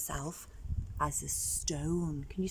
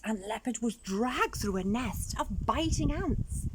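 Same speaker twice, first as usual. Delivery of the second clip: ordinary delivery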